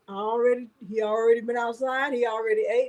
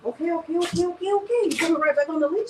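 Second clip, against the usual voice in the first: high, rising okays to settle the dog; high cooing okays for the dog; soothing dog; 7 semitones higher, faster than usual. Real, not acted. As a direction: coo at an excited dog to calm him. Calming excited dog.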